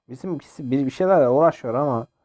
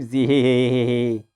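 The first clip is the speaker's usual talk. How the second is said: mock evil giggle. Player taunting opponents with a mock evil giggle. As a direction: cackle like a cartoon villain. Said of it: "Alın size bir güleyim".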